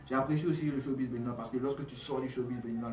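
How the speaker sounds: ordinary talk, nothing marked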